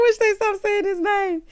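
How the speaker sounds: high, squeaky plea; helplessly amused high pitch; recovering from laughter. Real, not acted. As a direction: plead it in a high, laugh-strained voice. Speaking out of laughing fit.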